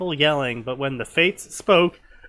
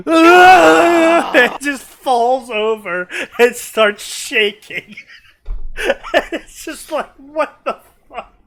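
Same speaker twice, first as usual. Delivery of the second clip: high, slow words squeezed through laughter; real fit of laughter; laughing hard; 10 semitones higher, 10 dB louder, much slower than usual. Real, not acted. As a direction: crack up while talking. Laughing at the seizure twist.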